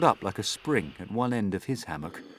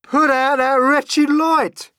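high, very loud, slow bellow; furious centipede shout; 15 dB louder, slower than usual. A narrator's reading. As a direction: hurl the words at the ceiling. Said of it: "he shouted".

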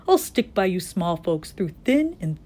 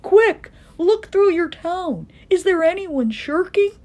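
high, urgent elephant call; calling back; 10 semitones higher, 5 dB louder than usual. A narrator's reading. Horton urges the mayor to find shirkers, calling back. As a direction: press them with anxious questions. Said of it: "And Horton called back".

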